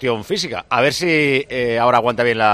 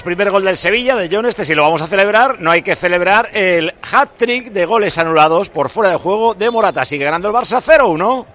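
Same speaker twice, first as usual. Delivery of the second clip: higher, louder studio update; excited; 6 semitones higher, 5 dB louder than usual. Real, not acted. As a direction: pass on the news excitedly.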